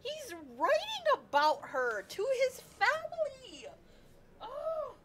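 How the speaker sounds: high, thrilled outburst; excited squeal. Real, not acted. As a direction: gasp it out with delight. Fangirl reacting to game scene.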